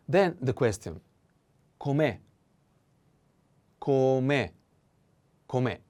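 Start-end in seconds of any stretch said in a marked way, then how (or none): 1.8-5.8 com'è with weight on the final e; stress on the e. Shown as a demonstration. Teacher drills com'è for students, stressing the e. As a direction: say com'è, landing on the e. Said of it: "The accent is on the e".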